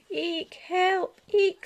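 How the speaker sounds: high, loud mouse squeaks of panic; panicked squeak. Acted. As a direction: squeal for help like a tiny mouse. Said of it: "squeaked Little Mouse".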